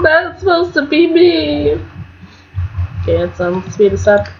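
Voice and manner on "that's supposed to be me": high, slow mock whine of a jealous rival; jealous Nancy. Gamer voicing jealous Nancy at the hospital, high mock whine. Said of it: "She's like".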